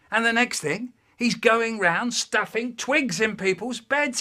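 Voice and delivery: hammed-up fatherly outrage; exasperated dad. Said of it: "And my dad goes".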